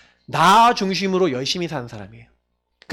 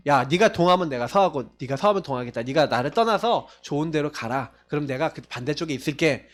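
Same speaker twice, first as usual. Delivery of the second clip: gentle offer to nephew; 6 semitones lower, 5 dB quieter than usual. Retold or imitated. Abraham offers Lot the choice.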